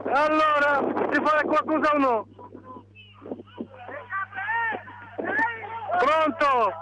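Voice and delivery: phone line call from a rooftop; tinny phone audio; over the phone. Real, not acted. Sì, ti sento.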